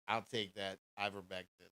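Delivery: normal talking voice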